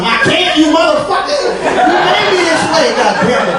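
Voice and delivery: high-pitched indignant cry; hammed-up anguished protest; angry outburst. Acted outburst at the light.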